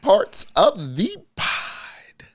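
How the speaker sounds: loud, slow hype intro; announcer voice. Acted. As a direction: announce the segment like a host. Announces podcast segment.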